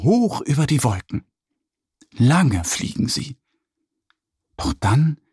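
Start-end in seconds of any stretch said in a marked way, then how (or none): none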